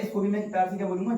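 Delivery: ordinary talk, nothing marked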